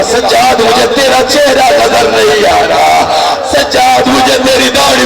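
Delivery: ordinary talk, nothing marked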